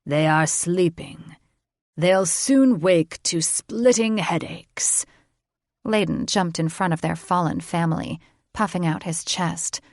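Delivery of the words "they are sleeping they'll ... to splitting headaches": slow, menacing and amused; a dragon king pleased with himself. A narrator's reading. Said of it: "the Dragon King chuckled".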